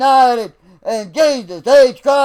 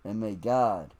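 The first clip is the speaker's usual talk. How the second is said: soft farewell; 20 dB quieter than usual. Real, not acted. Quiet closing blessing.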